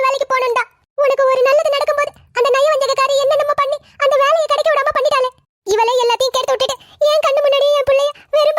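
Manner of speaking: crying, wounded complaint; tearful. Acted. Mother lamenting an insult to her son, weeping. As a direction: wail the grievance through tears. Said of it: "அழுது உடம்ப கெடுத்துக்காதீங்க".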